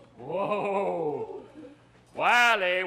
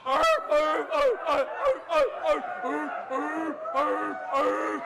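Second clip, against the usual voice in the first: dogs barking; 7 semitones higher, slower than usual. Retold or imitated. Storyteller barks as the dogs come running. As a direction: bark like running hounds. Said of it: "here come the dogs".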